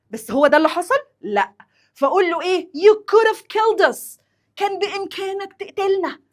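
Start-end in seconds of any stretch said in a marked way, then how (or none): 2.7-6.2 high, emphatic acted accusation; alarmed reproach. Wife telling husband he nearly killed them, alarmed reproach. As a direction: reproach him with voice raised high.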